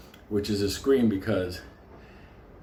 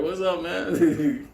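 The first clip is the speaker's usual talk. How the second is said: higher, slow drawn-out greeting through a laugh; 7 semitones higher, much slower than usual. Retold or imitated. Inmates greeting the cop, slow and laughing. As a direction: stretch it out, laughing. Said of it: "Motherfuckers like".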